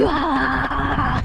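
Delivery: normal talking voice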